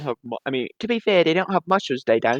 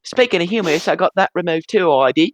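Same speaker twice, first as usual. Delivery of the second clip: lightly voiced cockney newsboy, higher; chirpy London paper boy accent; British paper boy voice; 5 semitones higher, 4 dB louder than usual. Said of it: "[name] is doing an impression of a British paper boy".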